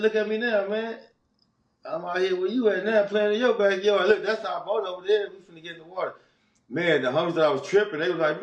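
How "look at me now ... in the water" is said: high, proud gloating; bragging taunt. Retold or imitated. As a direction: show off to your old jailer. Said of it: "I clowned him".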